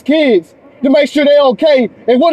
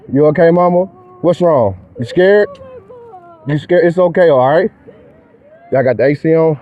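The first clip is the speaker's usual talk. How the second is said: gentle reassurance; 8 semitones lower than usual. Real, not acted. Officer comforting a scared child.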